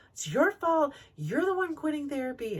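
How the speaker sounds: high, lightly voiced blaming; a hint of the husband pointing fingers; mild mock accusation; accusing husband.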